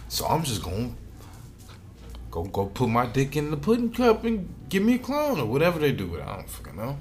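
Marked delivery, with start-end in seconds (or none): none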